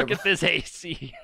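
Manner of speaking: lightly voiced old-time New Yorker; nineteen-thirties New Yorker. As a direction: playfully imitate an old-time city slicker. Joins the 1930s New Yorker bit.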